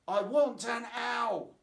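put-on high, slow, loud little girl's demand; repeating child. A narrator's reading. Lucy demanding an owl again, high, loud and slow. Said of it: "Lucy repeated".

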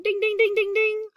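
high sung bell chime; high ding-ding chime. Pretend. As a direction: chime like a game-show bell. Teacher's sound effect bell.

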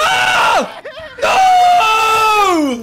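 terrified screaming; being chased. Real, not acted. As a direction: shriek in real panic. Monster comes in, screaming.

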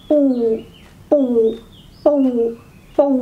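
ปู่ going down immediately, short; low tone. Shown as a demonstration. Teacher showing "like this", low dropping ปู่. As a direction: push ปู่ down without raising it first. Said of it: "Just go down immediately".

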